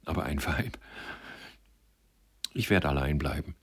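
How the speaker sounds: understated, subdued sorrow; holding back tears. A narrator's reading. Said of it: "erwiderte er mit zuckenden Lippen".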